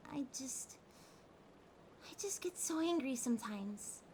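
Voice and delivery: whispered admission. Acted. Catgirl admitting her anger to her partner, whispered. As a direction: confess it under your breath.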